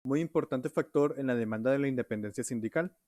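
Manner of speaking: ordinary delivery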